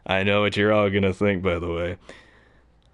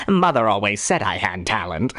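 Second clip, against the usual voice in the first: Joker voice; 3 semitones lower than usual. Retold or imitated. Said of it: "like, Cesar Romero".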